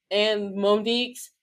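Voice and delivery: ordinary talk, nothing marked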